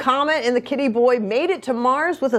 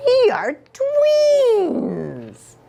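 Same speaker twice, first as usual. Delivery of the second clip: delighted; 13 semitones higher, much slower than usual. Acted. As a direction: stretch it with joy. Delighted twins claim.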